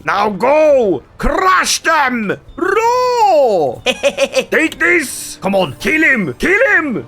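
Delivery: loud, high cries urging the troops on; battle cries. Acted. Commander urging his troops to kill, loud war cries. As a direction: roar your men forward.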